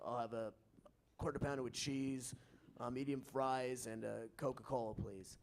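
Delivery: very low, soft drive-through order; deep, quiet, low-pitched dad voice; Billy's father. Acted. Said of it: "my deeper Billy's father voice".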